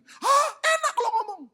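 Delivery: high, loud mock outrage; protesting retort. Churchgoer talking back to the pastor, protesting retort. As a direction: argue back with an incredulous yelp. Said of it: "kadang-kadang orang bantah, protes".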